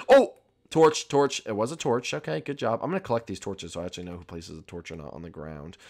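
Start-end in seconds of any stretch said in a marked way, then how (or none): none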